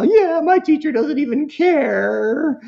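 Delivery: put-on high, drawn-out kid complaint; whining kid. Son grumbles to Mom about teacher, whining.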